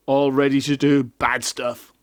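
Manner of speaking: plain reading voice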